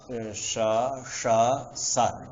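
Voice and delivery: श, ष, स each alone, with a pause between; three distinct sibilants. Shown as a demonstration. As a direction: move from palatal sh through retroflex sh to dental s. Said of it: "ऐसे करेंगे".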